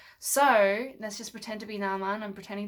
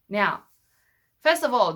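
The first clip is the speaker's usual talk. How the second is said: normal talking voice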